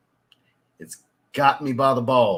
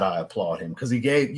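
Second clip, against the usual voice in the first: ordinary talk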